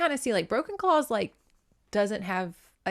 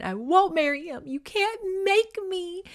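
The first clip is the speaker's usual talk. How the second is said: mock crying teenager; 10 semitones higher than usual. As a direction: whine it like a tearful, hammed-up teen. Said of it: "The teenage girl crying".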